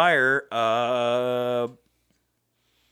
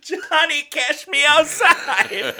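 mock high pun; 14 semitones higher, slower than usual. Silly pun delivery.

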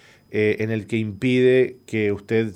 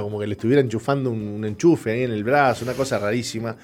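ordinary delivery

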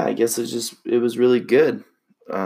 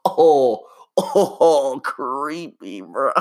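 laughing; 4 semitones higher, much slower than usual. Real, not acted. Reacting to his own reading.

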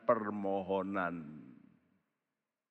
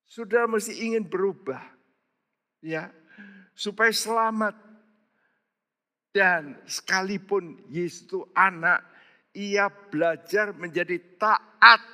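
high, pleading preacher's cry; high impassioned sermon voice; fervent preaching; 8 semitones higher, 3 dB louder than usual. Acted. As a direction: urge the congregation to pray hard. Preaching at full force.

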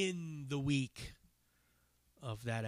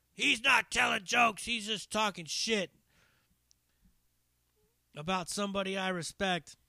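angry retort; 7 semitones higher, 5 dB louder than usual. Retold or imitated. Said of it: "started yelling at the dude".

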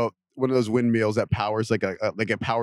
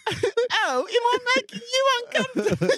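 very high, whiny mock British accent; hammed-up falsetto British accent; put-on British accent. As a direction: squeal it in a silly British voice. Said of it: "I broke into a British accent".

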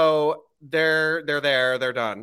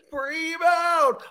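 high mock ring call; 12 semitones higher than usual. Other friend repeats the shout.

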